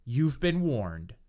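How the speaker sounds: normal talking voice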